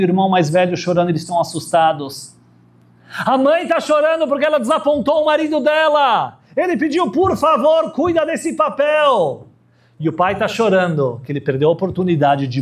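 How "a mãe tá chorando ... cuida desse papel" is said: emphatic sermon voice; preaching at full force. Acted. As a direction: rise up and call out to the congregation. Sermon story, pitched high.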